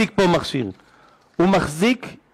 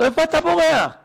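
wife's sharp question; 10 semitones higher than usual. Retold or imitated. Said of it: "אשתו אומרת".

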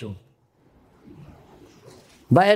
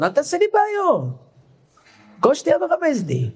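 exasperated complaint; 11 semitones higher than usual. Retold or imitated. Rabbi complains about constant hinting.

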